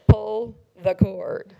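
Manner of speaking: very slow, low deadpan announcement; kid on the PA. Retold or imitated. Ride kid instructing the rider over the PA, deadpan announcer. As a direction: drone it out word by word over the PA. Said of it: "the kid comes on the P A".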